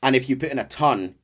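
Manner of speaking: usual speaking voice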